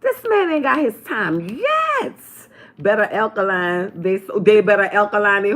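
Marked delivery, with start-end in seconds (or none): 0.0-2.2 high, emphatic retelling; quoting herself. Retold or imitated. Telling viewers what she said, emphatic high quote. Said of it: "I said".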